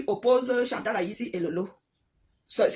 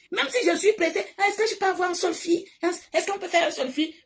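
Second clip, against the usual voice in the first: high eager fan voice begging for a selfie; pleading fan; 5 semitones higher than usual. Retold or imitated. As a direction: play a breathless fan chasing her.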